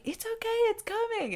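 husband soothing his wife. Retold or imitated. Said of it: "he's like".

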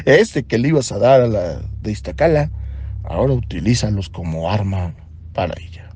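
usual speaking voice

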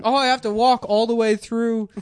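loud, high mock excuse; panicked stalling. Caught kid stalling mom through door, loud mock panic. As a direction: call it out to the next room.

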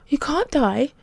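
high, urgent insistence; insistent. A narrator's reading. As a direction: insist on it, pitch jumping up. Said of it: "he insisted".

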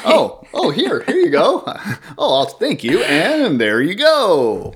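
very high, hammed-up politeness; mock-gracious exchange, drawn out at the end; over-eager CD swapper. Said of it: "and just be like".

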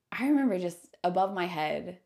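ordinary talk

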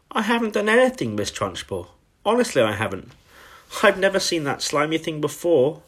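put-on high-pitched child protesting innocence; pleading schoolgirl. A narrator's reading. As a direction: plead like a wrongly accused little girl. Accused Matilda pleads innocence.

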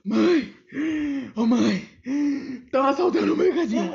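loud, breathless panicked call; calling out to mom. Retold or imitated. Girl bursting in home telling mom about robbery, loud and breathless. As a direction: yell for mom, half laughing. Running home yelling to mother.

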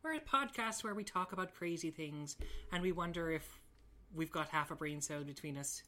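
lilting Irish podcast introduction; Irish accent. Shown as a demonstration. Host doing the requested Irish one, Irish accent. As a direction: put on a chatty Irish brogue. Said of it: "you should do your Irish one".

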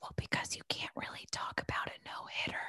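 whispered explanation. Shown as a demonstration. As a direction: whisper so you don't jinx it. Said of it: "I'm gonna whisper".

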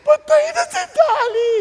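high, loud mock wail of grief; wailing shout. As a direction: cry out that the pet is dead. Children wail lamb is dead. (malakas na panaghoy ng mga bata)

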